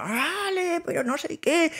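very high squealing crowd cry; hysterical fans. Retold or imitated. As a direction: mimic a hysterical girl shouting after them. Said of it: "gente histérica diciéndonos".

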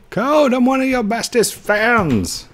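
high, drawn-out fan voice; gushing fan. Acted. Player reads a fan's message aloud, high and gushing.

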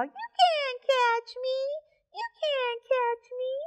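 squeaky sing-song taunt; teasing monkey voice. Acted.

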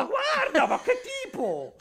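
high, laughing indignant burst; hammed-up angry grandpa; mock outrage. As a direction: fake fury, nearly laughing.

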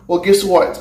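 ordinary delivery

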